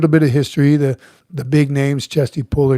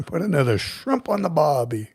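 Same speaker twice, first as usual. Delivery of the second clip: half-hearted Australian movie line; barely-there Australian accent; bad Australian impression; 4 dB quieter than usual. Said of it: "Terrible at impersonation".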